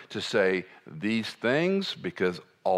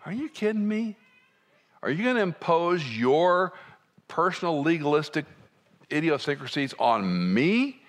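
higher, incredulous retort; indignant disbelief; 8 semitones higher than usual. Retold or imitated. Preacher voicing his inner objection to legalists, indignant. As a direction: push back, offended, in mock outrage. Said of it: "the hair on the back of my neck just goes".

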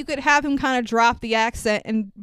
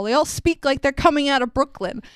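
normal talking voice

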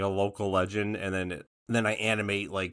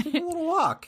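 high silly voice. Acted. Playful high voice.

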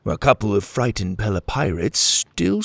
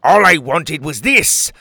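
raised, loud pirate declaration; villain's outburst; 5 semitones higher, 6 dB louder than usual. A narrator's reading. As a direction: declare it loudly as the thieving captain. Captain snatches the egg.

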